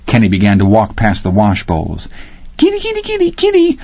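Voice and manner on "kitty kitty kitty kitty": very high sing-song kitty call; boy calling the tiger like a pet cat. Acted.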